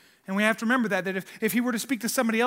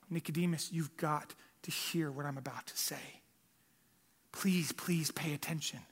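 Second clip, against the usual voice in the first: softer, pleading, slowed whisper; urgent whisper; 6 semitones lower, 11 dB quieter than usual. Retold or imitated. Jesus urges Nicodemus to listen, intense whisper. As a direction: grip him and whisper the warning. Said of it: "grabbing Nicodemus by the shoulders".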